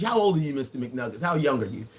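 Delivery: normal talking voice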